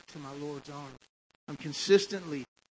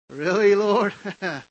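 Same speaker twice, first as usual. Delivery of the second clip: loud, higher-pitched exasperated plea; frustrated outburst; 6 semitones higher, 15 dB louder than usual. Retold or imitated. Preacher reliving his complaint to the Lord when the clutch failed, frustrated yelling. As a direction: shout your complaint up at heaven.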